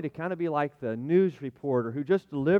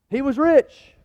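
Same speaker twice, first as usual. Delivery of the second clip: much higher and louder proclamation; emphatic exclamation; 9 semitones higher, 10 dB louder, slower than usual. Real, not acted. Pastor declares the rich man's wealth, ringing out. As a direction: belt it out to the hall. Preacher punctuating.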